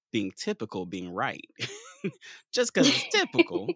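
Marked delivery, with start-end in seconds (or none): 2.0-3.7 laughing through the words, much higher. Real, not acted. Host laughing about ableist views while talking. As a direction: giggle out the point.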